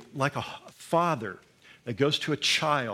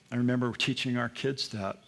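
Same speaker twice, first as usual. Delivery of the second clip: normal talking voice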